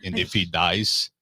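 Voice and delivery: put-on slow, low, flat Russian accent; Drago-style Russian accent, slow and low; Drago impression. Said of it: "Rocky Four".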